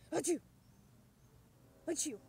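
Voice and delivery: two hammed-up sneezes; fake sneezes. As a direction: sneeze it out, playful. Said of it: "I have the sneezes".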